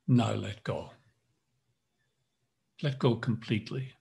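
calm instructor guiding each tense and release; guided yoga nidra. Acted. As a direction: lead the body scan, firm on tighten, easing on let go. Leads tense-relax yoga nidra.

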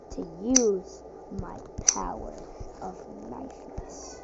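whispered villain boast, very soft; dramatic whisper. Pretend. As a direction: hiss it like a secret power. Dramatic villain power announcement.

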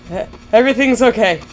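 normal talking voice

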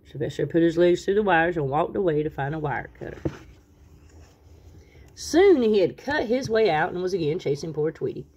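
ordinary delivery

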